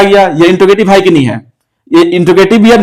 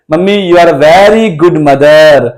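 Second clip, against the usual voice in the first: slow model sentence; much slower than usual. Shown as a demonstration. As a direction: say it slowly so children can copy. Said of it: "क्या कहेंगे?". (धीमा, साफ़ बोला गया नमूना वाक्य)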